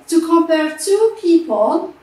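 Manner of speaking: ordinary talk, nothing marked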